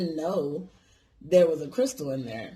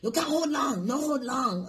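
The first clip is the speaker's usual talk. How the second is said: mock Chinese accent, pitched much higher, broken English; retold Chinese accent, higher and insistent; imitated Chinese accent; 9 semitones higher than usual. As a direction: do the shop lady's Chinese accent warning you off. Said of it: "Chinese lady".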